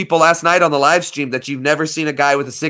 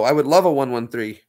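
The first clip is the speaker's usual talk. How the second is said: usual speaking voice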